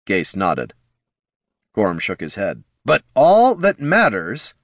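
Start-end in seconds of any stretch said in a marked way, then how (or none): 2.9-4.5 higher, louder, slow emphatic; loudly. A narrator's reading. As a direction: stress it for everyone to hear. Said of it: "Heraldon repeated loudly".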